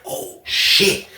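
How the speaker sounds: breathy whispered oh shit. Retold or imitated. Watching the fight start, a shocked whisper. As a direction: whisper it under your breath. His stunned reaction.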